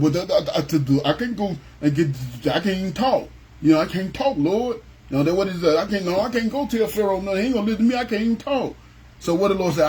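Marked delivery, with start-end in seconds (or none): 0.0-8.7 halting, stammering voice, slightly higher; a hint of Moses fumbling his words; stuttering Moses. Moses pleading with the Lord, stammering he can't talk. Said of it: "Moses used to stutter".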